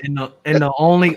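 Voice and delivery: usual speaking voice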